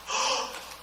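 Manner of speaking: sudden unvoiced gasp; mock gasp. Prospect realizes the timing is right, surprised gasp.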